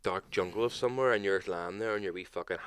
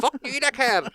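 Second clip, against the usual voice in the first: high, louder mock parrot squawk; 7 dB louder than usual. Imitating the dealer's parrot swearing at visitors, high and squawky. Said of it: "But this is".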